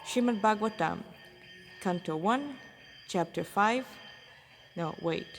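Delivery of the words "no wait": ordinary delivery, nothing marked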